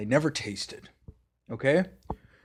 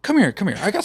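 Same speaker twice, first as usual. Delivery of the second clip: mock eager call; 8 semitones higher, 7 dB louder, faster than usual. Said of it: "you're just like".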